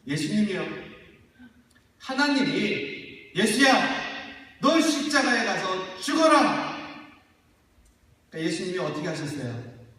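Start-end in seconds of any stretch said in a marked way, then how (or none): 3.3-6.8 loud, high, drawn-out call to Jesus; booming fatherly command; God's command. Acted. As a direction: call down as the Father giving a hard order. Voicing God commanding Jesus.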